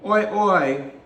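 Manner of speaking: put-on high, loud British-accented call; mock British accent. Imitating [name]'s beef with British people, mock British accent. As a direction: shout it in a hammed-up British accent. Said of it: "like, a British accent".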